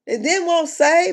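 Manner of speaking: ordinary talk, nothing marked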